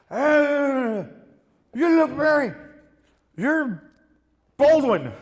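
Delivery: put-on high, loud tipsy girl voice; imitating a drunk fan girl, high and loud.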